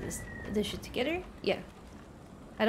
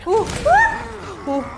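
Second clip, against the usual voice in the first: high, loud startled yelling; 12 semitones higher, 9 dB louder than usual. Real, not acted. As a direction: yell out in alarm at the screen. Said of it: "Sorry, I'm yelling".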